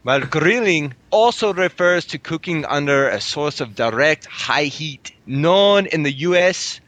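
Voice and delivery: high, lilting Irish brogue; Irish accent pitched well above natural voice. Acted. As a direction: read it out in a put-on Irish accent, aiming at Scottish. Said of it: "I can do it in Irish".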